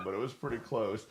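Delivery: normal talking voice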